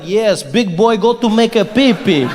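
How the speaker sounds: mock-tough Russian accent; hammed-up Russian accent for toddler talk. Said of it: "speak to myself in a Russian accent".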